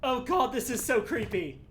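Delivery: loud scared whining; angry crying. Real, not acted. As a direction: whimper it out, hating every second. Scared repeating in creepy area.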